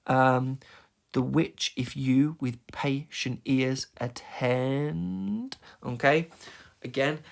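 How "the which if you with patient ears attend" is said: "The WHICH, if YOU", very slow and scanned; stress on the dum. Shown as a demonstration. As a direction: drag it out beat by beat, stretching "attend" upward. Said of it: "I'll pick another one".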